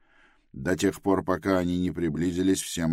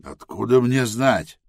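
snapping back; 6 semitones higher, 6 dB louder than usual. Acted. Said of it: "огрызнулся Тревис".

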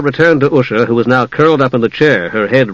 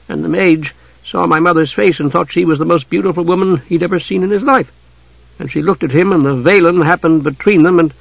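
put-on higher-pitched, lively girl storyteller; young Usha; 5 semitones higher than usual. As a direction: tell it as the young heroine, pitched up. Usha continues her tale.

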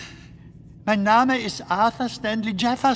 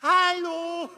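high call from far off; distant call; 9 semitones higher than usual. Acted. Lost man calls hello into the darkness, distant and high. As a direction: shout it from far away down the tunnel. Calling out in the dark.

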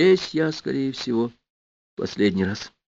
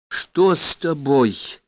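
high, slow, plaintive question; moaning; 5 semitones higher, much slower than usual. A narrator's reading. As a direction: moan it out, drawing the words long. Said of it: "простонала моя первая комсомольская жена". (протяжно и высоко, со стоном)

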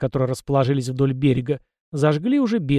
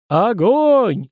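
raised, high-pitched call into a microphone; shouting; 8 semitones higher, 5 dB louder than usual. A narrator's reading. Blogger into the microphone to the crowd, shouting. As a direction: cry out to grab the crowd's attention. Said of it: "закричал в микрофон Луль".

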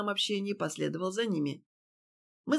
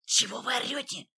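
hissing; 4 semitones higher than usual. A narrator's reading. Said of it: "зашипел Фатьянов".

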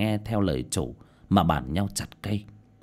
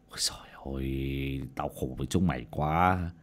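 weary whispered sigh; 8 semitones lower, 8 dB quieter than usual. A narrator's reading. Boss hears of the death.

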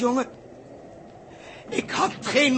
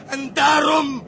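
louder, higher anguished shout; outburst; 6 semitones higher, 9 dB louder, slower than usual. Acted. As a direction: burst out with the confession. Confessing why he kicked ball. (uitbarsting van schuld)

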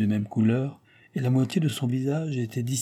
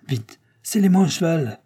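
high, louder urgent order; urgent demand; 7 semitones higher, 5 dB louder than usual. A narrator's reading. Courier ordering a horse at the inn, urgent. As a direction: demand it at once, voice raised.

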